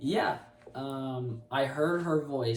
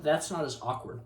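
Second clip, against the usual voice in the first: ordinary delivery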